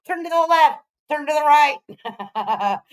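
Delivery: slow, laughing mock instruction. Real, not acted. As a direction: drag it out while laughing.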